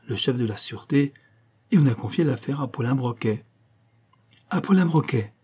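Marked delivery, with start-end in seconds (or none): 4.5-5.3 exclamation étonnée, nettement plus aiguë; s'exclamer, voix qui monte haut sur le nom; exclaiming. A narrator's reading. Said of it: "s'exclama la Rochardie". (le capitaine apprend le nom de l'enquêteur, exclamation aiguë)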